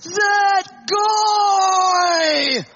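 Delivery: loud, high angry yell on waking; angry outburst. Retold or imitated. Husband yelling about the emailer as he gets out of bed. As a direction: blurt it out in a rage. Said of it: "he goes".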